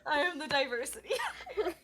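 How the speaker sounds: quoting through laughter; giggling while repeating the line; laughing. Retold or imitated. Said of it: "Merope's like".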